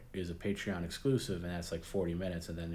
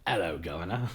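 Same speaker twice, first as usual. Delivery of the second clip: quick mock Cockney greeting; bad English accent; 3 dB louder than usual. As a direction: toss off a terrible fake English accent. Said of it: "call in with, like, the absolute worst".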